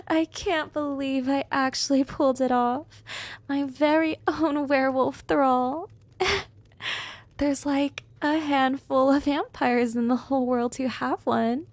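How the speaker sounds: thrilled, triumphant vampire; gleeful gloating. Acted. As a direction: marvel giddily at your own success. Vampire thrilled with her new thrall.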